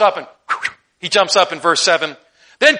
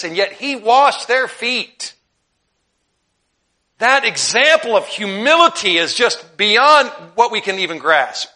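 slow fervent preaching; 5 semitones higher, 5 dB louder, slower than usual. Acted. Preaching on Christ's humility.

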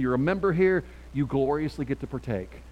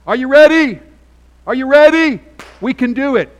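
shouted, much higher and louder rally cry; pep rally cheer; 11 semitones higher, 17 dB louder than usual. Acted. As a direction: fire up the crowd like a cheerleader. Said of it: "a pep rally that says".

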